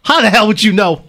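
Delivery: high, loud acted outburst; hammed-up furious confrontation; angry son. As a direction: shout the accusation at her. Acted quarrel with mother.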